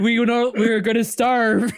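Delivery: high, loud hammed-up alarm; mock panic. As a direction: cry out in comic mass hysteria. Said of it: "the ant civilization just goes into panic".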